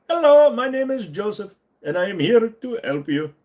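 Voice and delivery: put-on high, sugary Indian accent, very friendly; fake-polite Indian accent greeting; scammer sales voice. Scammer introducing himself to a victim, sugary Indian accent.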